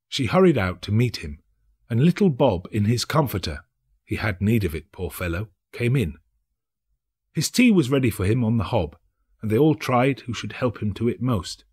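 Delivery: ordinary delivery, nothing marked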